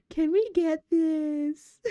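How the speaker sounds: high, pleading call of a name; whiny plea. Retold or imitated. Begging partner Jose for merch, high whiny plea. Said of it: "I always just go".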